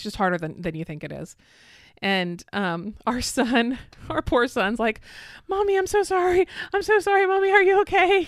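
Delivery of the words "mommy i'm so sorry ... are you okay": put-on much higher, louder child's voice pleading sorry; worried little boy. Son apologizing to mom after the flip, high frantic child voice. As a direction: son begging his mom, high and anxious.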